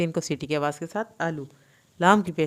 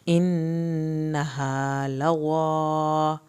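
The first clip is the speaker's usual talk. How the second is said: slow recitation; much slower than usual. Shown as a demonstration. Teacher recites verses to students, slow chant. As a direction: recite slowly, stretching the vowels. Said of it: "ہا کو بھی کھینچنا ہے".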